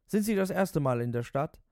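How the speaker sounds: a hint of a pushy stranger; barefoot passenger.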